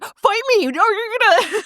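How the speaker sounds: high squeaky playful threat; squeaky hammed-up dare; mock fierce challenge. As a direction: play at picking a fight. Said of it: "She's like".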